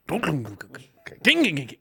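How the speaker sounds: soft imitated engine knock. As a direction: mimic a sputtering engine.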